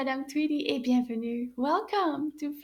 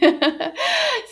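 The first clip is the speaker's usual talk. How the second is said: high giggle; 12 semitones higher, 5 dB louder than usual. Acted. Puppet laughs.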